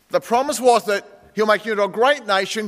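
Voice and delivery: usual speaking voice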